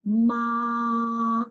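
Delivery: long, level 'ma' held flat; first tone. Shown as a demonstration. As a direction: hold 'ma' on one steady note, no rise or fall. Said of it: "First tone is represented by".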